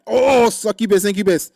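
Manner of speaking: high, explosive cry of recognition; startled shout as it hits you; sudden loud outburst. Real, not acted. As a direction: blurt it out loudly, way up high.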